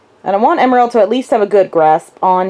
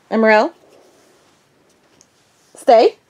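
normal talking voice

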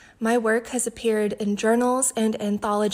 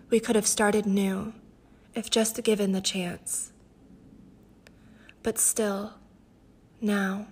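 poem reading. Acted. Reads her own poem aloud.

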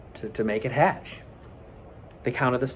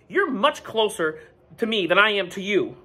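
arguing Goose; 9 semitones higher than usual. Acted. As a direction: bicker back like a goose.

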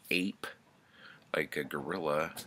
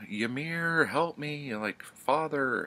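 higher, pleading call to a father; mock cry for help; 11 semitones higher, 4 dB louder, slower than usual. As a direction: cry out for rescue in a hammed-up wail. Daughter calls to her father.

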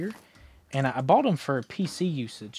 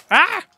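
startled yelp; 6 dB louder than usual. Real, not acted. Startled by enemy hit.

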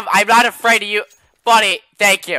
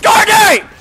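panicked scream; 12 semitones higher, slower than usual. Acted. Fleeing player screams for Courtney in mock panic. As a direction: scream for her as you flee.

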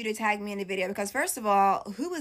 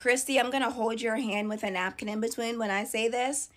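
ordinary delivery

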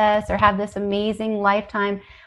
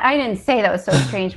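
normal talking voice

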